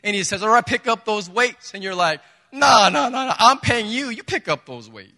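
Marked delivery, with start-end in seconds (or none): 0.4-1.7 louder, higher coach command; a trainer ordering his client, lightly voiced; brisk trainer. 2.5-5.1 loud, high, laughing protest; laughing refusal. Retold or imitated.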